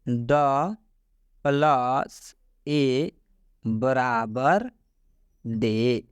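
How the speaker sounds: each sound drawn out very slowly; slow classroom spelling. Shown as a demonstration. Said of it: "मतलब कि".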